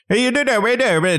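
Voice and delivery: high-pitched mock Irish cheer; a lilting Irish "we did it"; over-the-top Irish cheer; mock Irish accent. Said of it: "rustic, rural, Irish".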